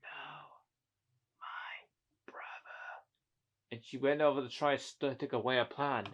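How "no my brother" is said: soft, drawn-out pretend whisper; hushed whisper. A character murmuring about their brother, hushed whisper. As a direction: whisper it very slowly.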